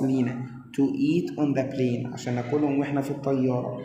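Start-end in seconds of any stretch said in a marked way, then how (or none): 0.8-2.1 slow dictation. Shown as a demonstration. Teacher reading example sentence.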